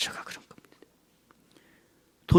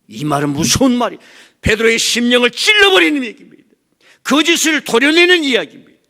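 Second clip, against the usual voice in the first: high, loud, driving sermon cry; full-force preaching; 13 dB louder than usual. Acted. Preacher to the congregation about Jesus' rebuke, full-force preaching. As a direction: preach it out at the top of the voice.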